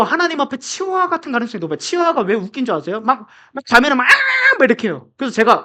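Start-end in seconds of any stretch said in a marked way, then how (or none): 4.0-4.1 imitated snappy little-dog shriek; shrill chihuahua yap. Preacher showing how a chihuahua reacts to visitors, a shrill mock yap. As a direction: yelp like a feisty tiny dog. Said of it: "앙칼지게".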